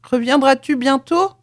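loud, high call after someone leaving. A narrator's reading. The wife calling after her husband as he leaves, loudly. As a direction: call across the distance to him. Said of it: "La Rollet cria à son tour".

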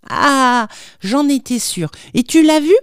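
put-on high, excited little-boy voice; eager child. A narrator's reading. Child asking father about seeing the angel, eager. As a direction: rise eagerly into the question.

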